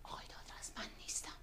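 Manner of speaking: urgent hushed whisper. Acted. Whispering to the colleague at the phone, tell her I'm not here. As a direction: whisper so the caller won't hear.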